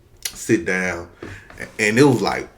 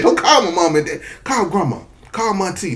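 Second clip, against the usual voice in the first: high, loud desperate begging; pleading; 11 semitones higher, 3 dB louder than usual. Retold or imitated. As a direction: beg them not to call. Begging principal to call grandma.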